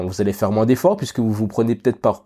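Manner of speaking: ordinary talk, nothing marked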